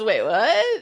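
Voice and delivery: rising, incredulous question, playfully acted; mock double take. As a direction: imitate someone caught off guard. Repeating character's stunned reaction.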